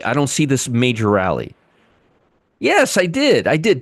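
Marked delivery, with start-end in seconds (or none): 2.6-3.8 much higher, mock-indignant sarcasm; sarcastic. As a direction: say it with exaggerated sarcasm. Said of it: "I'm being sarcastic".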